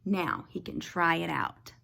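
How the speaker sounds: ordinary delivery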